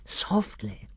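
soft warning to keep it down; hushed caution. A narrator's reading. Lord cautioning his fellow plotter, hushed. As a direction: hush your companion gently.

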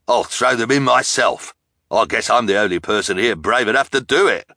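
loud, impatient villain. A narrator's reading. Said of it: "stepped forward impatiently".

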